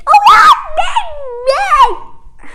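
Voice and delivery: screaming high and loud that it's too big; loud high-pitched yelp at the huge slipper; shocked shout. Acted. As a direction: shriek it in disbelief. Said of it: "They were like".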